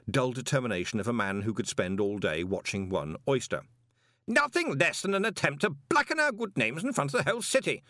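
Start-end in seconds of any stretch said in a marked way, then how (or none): 4.3-7.8 much higher, outraged protest; pompous outraged banker; indignant protest. Acted. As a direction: protest as if wronged before the city. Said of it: "Stoley was protesting".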